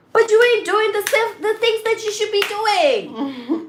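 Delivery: exasperated rant. Real, not acted. Heated rant at her partner.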